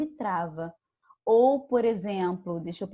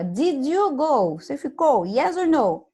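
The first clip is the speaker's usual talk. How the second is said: emphatic question; 5 semitones higher, 6 dB louder than usual. Shown as a demonstration. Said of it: "teacher asks the class".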